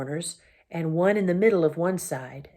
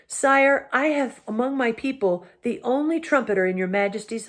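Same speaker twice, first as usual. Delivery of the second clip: higher, proud piping speech; honour-bound little knight pleading; courtly mouse knight; 5 semitones higher than usual. Acted. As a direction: mouse knight asking the king for a role. Reepicheep's voice.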